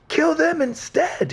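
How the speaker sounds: very high, slow, loud begging; old man begs the bear to take the girls; pleading old man. Acted. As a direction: beg the bear, shrill and drawn out. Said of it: "he pleads".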